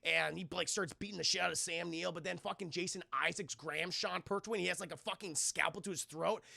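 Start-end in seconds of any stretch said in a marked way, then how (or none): none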